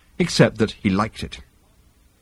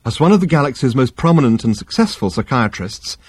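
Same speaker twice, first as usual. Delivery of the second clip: usual speaking voice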